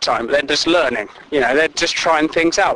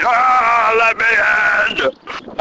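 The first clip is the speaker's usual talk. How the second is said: mock wailing; 6 semitones higher, much slower than usual. Stupid people wailing at lock.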